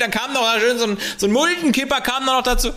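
high, mock bragging worker voice; excavator driver voice. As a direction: boast about the shovels, squeaky. Continues excavator driver impression.

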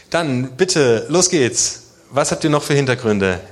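normal talking voice

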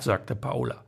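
usual speaking voice

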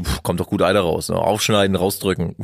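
low, matter-of-fact imitation of a doctor; deadpan chief doctor. Chief doctor pressing out pus, imitated deadpan. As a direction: lightly play her unmoved, all business. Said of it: "keine Mime verzogen".

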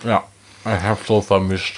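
chewing while talking; words round a bite of food; mouth full. Real, not acted. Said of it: "mit vollem Mund reden".

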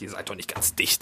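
low hushed whisper of a private insult; voiced inner thought, whispered. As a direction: whisper it as if only to yourself. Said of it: "da hab ich gedacht".

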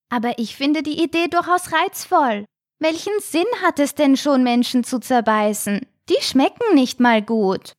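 high-pitched youngster pleading his case; protesting little shark. A narrator's reading. Little shark argues with his father, protesting. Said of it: "protestierte der kleine weiße Hai".

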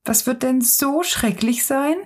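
a touch of apprehension, voice lifted; uneasy question. A narrator's reading. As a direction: ask a little anxiously. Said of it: "nun doch etwas beunruhigt".

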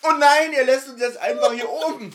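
hammed-up, stretched-out complaint; mock protest. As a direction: play-act being left behind, slowly. Acting out a stranded character's protest.

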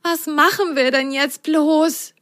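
a small sock lightly wailing; whining little sock. Acted. Lu stuck on the vine, high mild whine. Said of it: "jammerte Lu".

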